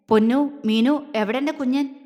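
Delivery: sternly measured questioning; grave, stern. A narrator's reading. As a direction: call both names, then demand gravely. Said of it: "ഗൗരവത്തോടെ ചോദിച്ചു".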